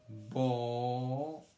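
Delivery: BONITA drawn out as BO, NI, TA; syllable by syllable. Shown as a demonstration. Teacher saying bonita while writing it, syllable by syllable. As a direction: stretch each syllable of BONITA slowly. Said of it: "Yo la escribo".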